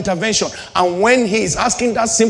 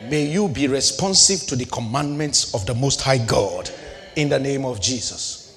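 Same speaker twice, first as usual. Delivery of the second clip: ordinary talk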